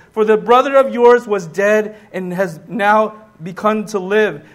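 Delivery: loud, emphatic preaching, every line pressed home; full-force preaching. Acted. Preacher proclaiming Paul's joy to the congregation, loud and emphatic. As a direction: preach it like it's fact.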